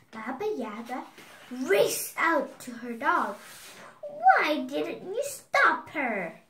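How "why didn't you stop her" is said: loud, high, drawn-out demand; angry shout. A narrator's reading. Baba Yaga scolds her dog, loud slow shout. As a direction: shout it slowly as the furious witch. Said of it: "she shouted".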